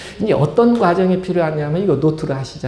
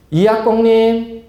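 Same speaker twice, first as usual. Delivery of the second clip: drawn-out high call of a patient's name, like a clinic nurse; 5 semitones higher, slower than usual. Acted. Clinic nurse calling the patient in from the waiting room, high and drawn out. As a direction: call the next patient slowly and sing-song.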